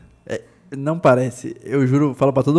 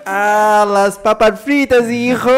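slow, high mock Argentine Spanish; Spanish accent; 6 semitones higher, 7 dB louder, much slower than usual. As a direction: ham up an Argentine waiter. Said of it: "O cara é argentino".

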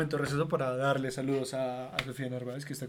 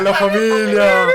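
drawn out, much louder shout; loud call; 3 semitones higher, 12 dB louder, slower than usual. Acted. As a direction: shout it to the fans. Grito a la audiencia. (gritando a la familia)

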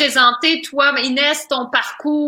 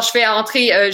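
ordinary talk, nothing marked

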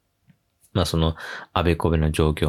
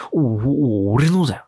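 mock territorial grunt; 5 semitones higher, 4 dB louder than usual. Senior guarding the sushi.

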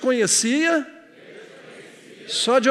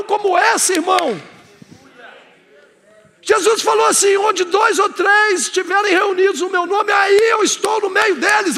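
higher, louder fervent preacher; full-force preaching; 7 semitones higher, 4 dB louder than usual. Acted. Pastor rousing the church to feel Jesus present, fervent preaching. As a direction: preach it to the congregation with fire.

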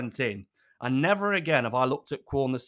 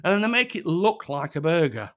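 normal talking voice

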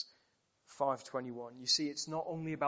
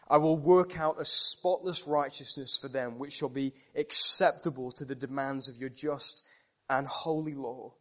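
loud, earnest vow, building in force; fervent preaching; 6 dB louder than usual. Acted. Preacher voicing the Son pledging to the Father, fervent. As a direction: promise it as a solemn covenant. Voicing Spurgeon's imagined Christ.

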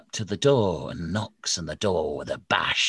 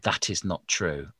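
usual speaking voice

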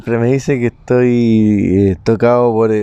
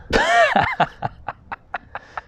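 high laughing burst; 5 dB quieter than usual. Real, not acted. Drunk friends joking.

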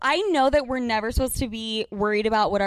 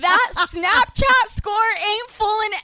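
mock loud verdict; 7 semitones higher, 4 dB louder, slower than usual. Slow loud declaration.

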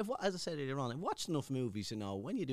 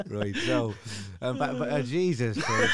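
shouted through laughter; loud laughing exclamation; 10 dB louder, slower than usual. Real, not acted. Laughing at a joke.

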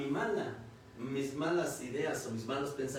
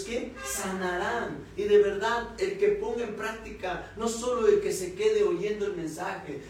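raised, emphatic sermon delivery; preaching; 5 semitones higher, 5 dB louder than usual. Real, not acted. Preacher testifying to the congregation about obeying God, emphatic preaching.

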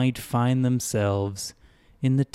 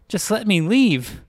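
higher, louder demand; 11 semitones higher, 5 dB louder than usual. Acted. The girl demands the greeter let her leave, higher and louder. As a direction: insist hard, fed up. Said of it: "demanded Wren".

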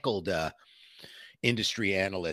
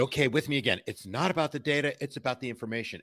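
raised-pitch mock chant leader; analyst leading audience; 6 semitones higher, faster than usual. Analyst getting the audience to repeat after her, mock rallying. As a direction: imitate a speaker prompting a crowd to repeat. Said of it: "tried to get the whole audience to repeat after her".

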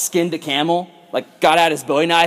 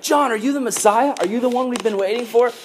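higher-pitched, wondering questions; eager questioning; 7 semitones higher than usual. Acted. People asking John in the wilderness, eager questioning. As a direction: ask it up high, as an eager crowd.

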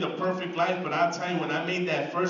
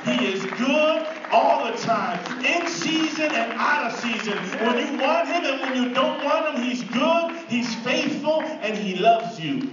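half-sung, higher and louder over music; preaching at full force; 6 semitones higher, 4 dB louder, slower than usual. Real, not acted. Preacher rising into song for the congregation. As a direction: lift it up, singing the praise.

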